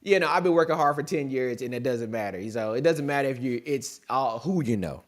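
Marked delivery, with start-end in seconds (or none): none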